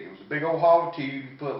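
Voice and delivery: usual speaking voice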